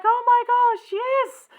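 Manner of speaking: loud, high-pitched delighted outburst; so happy. Retold or imitated. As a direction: act out a king overjoyed by the news. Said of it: "he gets so happy".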